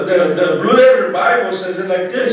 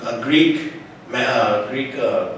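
ordinary talk

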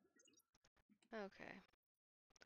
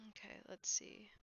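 whispering; 3 dB louder than usual. Real, not acted. Late night, keeping voice down.

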